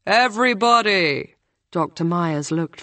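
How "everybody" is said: a calling tutor, a touch higher. A narrator's reading. Tutor calling for everyone's attention at the party, mild call. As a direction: summon the room to attention. Host calls the room.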